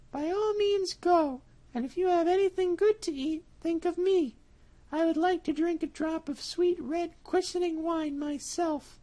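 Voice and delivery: a trusting mouse sending him off; little mouse. Acted. Mouse answers cat.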